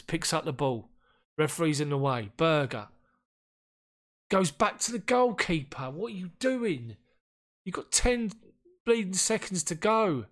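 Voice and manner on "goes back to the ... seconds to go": higher, louder furious rant at the defender; exasperated yell. Real, not acted. Manager yelling at his defender wasting the last seconds, furious. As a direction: shout at the screen in disbelief.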